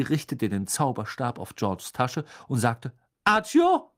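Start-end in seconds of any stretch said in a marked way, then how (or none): 3.2-3.9 high, loud incantation; commanding spell. Acted. Mother summons hidden sweets, loud high command. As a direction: call out the spell as an order.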